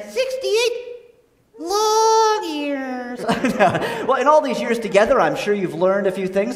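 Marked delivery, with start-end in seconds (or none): 0.0-3.2 squeaky ventriloquist dummy. Acted. Dummy complains about marriage.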